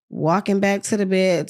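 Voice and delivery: usual speaking voice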